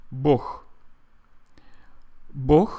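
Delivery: "Bog" ending in a kh sound; Russian pronunciation. Shown as a demonstration. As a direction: say "Bog" as bokh. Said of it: "Russian people pronounce it".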